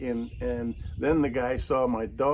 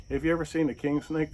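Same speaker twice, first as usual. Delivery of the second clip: ordinary talk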